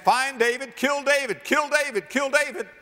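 high, urgent chant of a mob; crowd's manhunt cry. Acted. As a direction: voice a whole kingdom clamouring, pitched high. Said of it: "everybody is saying".

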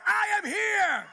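slow, high, loud shout of I am here; shouted call. Shown as a demonstration. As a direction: shout it out for the whole church. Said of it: "Somebody shout".

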